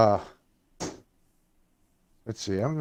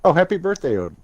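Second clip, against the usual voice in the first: excited greeting; 6 semitones higher, 6 dB louder, faster than usual. Real, not acted. Hearing of viewer's birthday.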